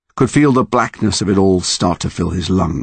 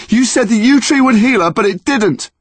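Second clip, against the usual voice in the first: angry shout; 5 dB louder than usual. Acted. Boy shouting at monster.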